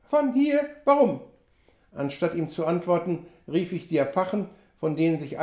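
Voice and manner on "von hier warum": high, rising "Von hier?"; a startled, high query; surprised question. A narrator's reading. Sam asks the narrator why they must leave, startled. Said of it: "Sam surprised".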